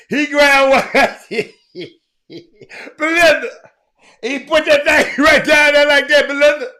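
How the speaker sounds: words squeezed out through laughter; can barely get it out for laughing; laughing while telling. Real, not acted. Host cracking up telling viewers a wild story, laughing through it.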